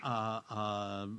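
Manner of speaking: normal talking voice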